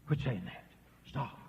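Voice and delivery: ordinary delivery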